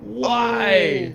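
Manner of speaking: high, loud cry of disbelief; disbelieving cry. Real, not acted. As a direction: ask it in sheer disbelief. Disbelief at player's mistake.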